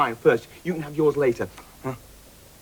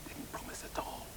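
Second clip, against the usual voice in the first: whispered aside; 22 dB quieter than usual. Acted. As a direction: sly whisper behind her back. Aside so Sue won't hear.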